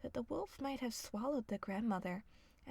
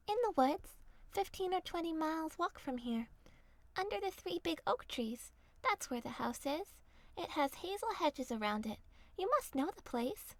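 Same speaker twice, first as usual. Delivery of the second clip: put-on chirpy child answer; little girl voice; 5 semitones higher than usual. A narrator's reading. Girl telling the wolf her destination, higher.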